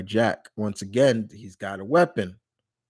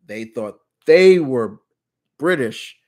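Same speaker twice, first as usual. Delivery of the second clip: "they were" hit louder and drawn out; correcting a mix-up, leaning hard on "they"; stress on THEY; 2 semitones higher, 7 dB louder, slower than usual. Real, not acted. Correcting viewer on who seemed British, stress on "they".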